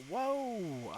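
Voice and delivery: sudden high startled whoa; surprised whoa. Real, not acted. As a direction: caught off guard, yelp it out. Software does something unexpected.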